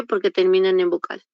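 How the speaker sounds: normal talking voice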